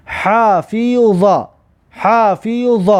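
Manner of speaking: حافظ very slowly, its ح made in the throat; teacher's drawn-out حافظ with throaty ح. Shown as a demonstration. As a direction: stretch each syllable of حافظ, pharyngeal h first.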